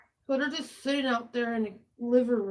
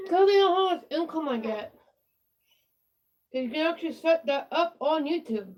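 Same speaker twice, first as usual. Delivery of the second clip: mouth full; 4 semitones higher, 5 dB louder than usual. Real, not acted. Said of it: "you wonder why I'm talking weird? ... Pins in my mouth".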